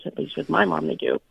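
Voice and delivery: usual speaking voice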